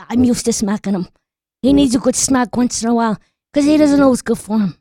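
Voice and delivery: ordinary talk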